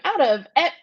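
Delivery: high incredulous laughing squeal. Retold or imitated. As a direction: squeal it through laughter in disbelief. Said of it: "Earth was like".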